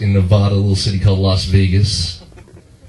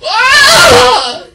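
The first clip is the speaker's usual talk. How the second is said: piercing high scream, imitating his mom; loud, long falsetto shriek; mother's shriek; 6 dB louder than usual. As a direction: scream out as if suddenly hit. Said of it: "when I heard her go".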